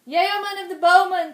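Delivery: loud, high commanding king; king shouting orders through the palace; ringing royal command. Acted. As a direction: boom it across the palace. King summoning.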